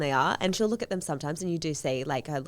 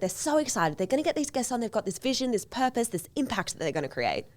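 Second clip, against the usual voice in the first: high, eager build-up; bubbling with a beginner's excitement; so excited; 5 semitones higher, 3 dB louder than usual. Acted. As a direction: gush through the plans with rising enthusiasm. Acts out would-be podcaster's excitement.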